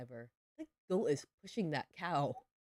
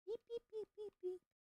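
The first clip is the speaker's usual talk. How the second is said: much higher, squeaky playful beeps; silly beeping; 4 dB quieter than usual. Pretend. Gamer beeps at her farm animals, squeaky and silly. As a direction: chirp it like a toy.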